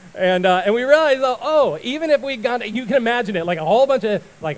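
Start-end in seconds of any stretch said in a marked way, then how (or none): none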